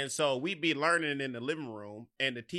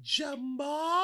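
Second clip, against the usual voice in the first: higher, rising mock surprise; surprised teacher; 12 semitones higher, 3 dB louder than usual. Teacher recognizing Jamar years later, mock surprise. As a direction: call his name like you can't believe it. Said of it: "It was like".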